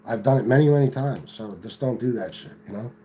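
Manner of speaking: ordinary talk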